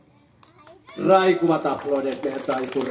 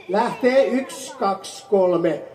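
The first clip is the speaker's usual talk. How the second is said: slow countdown; 3 semitones higher, slower than usual. Pretend. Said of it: "teidän piti sanoa taikasana".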